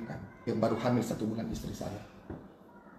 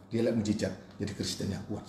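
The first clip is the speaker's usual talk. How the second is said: ordinary talk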